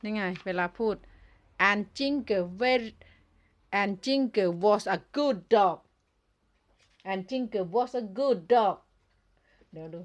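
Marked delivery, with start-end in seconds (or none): 1.7-8.8 very slow, loud, each word spelled out; loud and slow, like reading to a toy; slow clear English. Shown as a demonstration. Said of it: "ต้องพูด ภาษาให้ชัดนะคะ".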